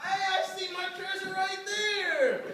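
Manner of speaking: high leprechaun voice. Acted. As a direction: point at the gold like a leprechaun. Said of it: "said in his leprechaun voice".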